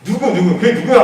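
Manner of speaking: king snaps out who, rising high and loud; angry demand. Acted. King furious to learn of the plot, demanding loudly. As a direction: demand the name in sudden rage. 왕이 화가 난 거예요.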